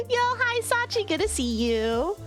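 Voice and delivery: normal talking voice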